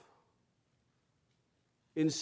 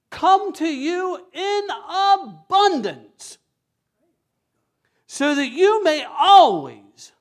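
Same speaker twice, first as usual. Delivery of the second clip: high, loud, rising preacher's cry; preaching at full force; 9 semitones higher, 9 dB louder, slower than usual. Acted. Pastor exhorts congregation about grace, loud impassioned preaching. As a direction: drive the promise home to the crowd.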